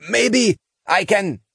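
put-on old engineer voice. Engineer protesting.

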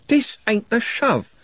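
high, slow defiant retort. A narrator's reading. Trev retorting to gang leader Andy, defiant. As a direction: throw it back at him.

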